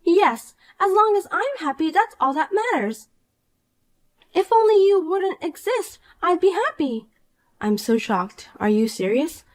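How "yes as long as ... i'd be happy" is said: put-on higher, smug and gleeful young woman; Poppy, gloating. Poppy gloats to the wife about meeting Edward, higher and smug. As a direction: crow over your win like a spoiled brat.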